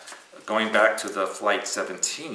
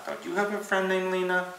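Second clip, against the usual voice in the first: teasing; 10 semitones higher than usual. Real, not acted. Teasing cohost about Lena.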